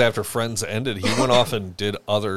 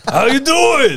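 cartoon Joey voice; 10 dB louder, slower than usual. Imitates Joey as a cartoon character.

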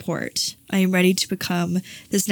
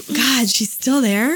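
higher, slow mock exasperation; imitating exasperated listeners; 6 semitones higher, slower than usual. Imagined listeners tired of her story, mock exasperation. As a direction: sigh it like bored listeners. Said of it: "the listeners on this show being like".

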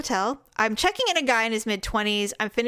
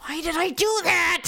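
self-reproach; 7 semitones higher than usual. Retold or imitated. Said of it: "I'll lay in bed ten years later and going".

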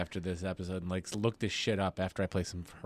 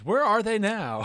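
high, loud mock TV-special voice; follow-up show announcer; 11 semitones higher, 7 dB louder than usual. Co-host spoofs a follow-up episode, mock announcer voice. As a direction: pitch it up like a hyped episode title. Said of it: "the follow-up episodes".

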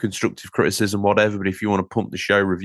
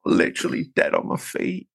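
exhausted whisper; 9 semitones higher than usual. Pretend. Plays along as exhausted gamer.

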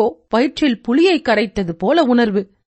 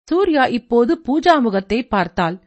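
usual speaking voice

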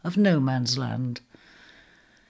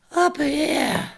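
weak, higher call from above; feeble cry; 10 semitones higher than usual. Acted. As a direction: call out with the last of your strength. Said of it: "cried a feeble voice".

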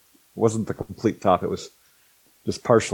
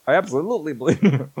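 higher, hurried reassurance; flustered backpedal; 9 semitones higher, faster than usual. Retold or imitated. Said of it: "I'm like".

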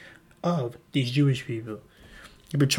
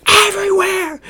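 much higher and louder yell of disbelief; exasperated Adam shout; 11 dB louder than usual. Acted. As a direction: explode at her in exasperation. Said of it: "and Adam's like".

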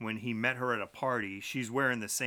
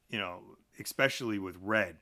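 ordinary delivery

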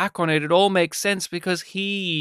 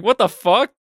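angry outburst; 5 semitones higher, 5 dB louder than usual. Retold or imitated. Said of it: "blew up at him".

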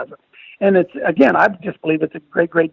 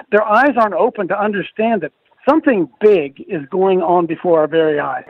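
ordinary delivery

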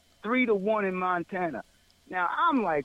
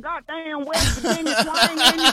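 amused and louder; laughing; 3 semitones higher, 5 dB louder than usual. Real, not acted. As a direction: crack up, louder, while talking. Naming an old twang, laughing.

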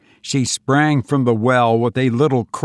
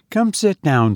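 put-on light, higher girl's voice; young woman calling; 7 semitones higher than usual. A narrator's reading. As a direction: invite him in a higher, girlish voice. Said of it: "cried Olive".

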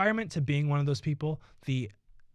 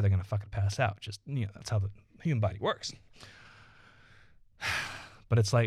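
ordinary talk, nothing marked

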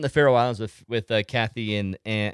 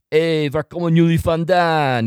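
loud, higher hail in Dutch; calling out; 6 semitones higher, 8 dB louder than usual. Retold or imitated. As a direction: call out to passing strangers in Dutch. Rehearses calling out to passing Dutch.

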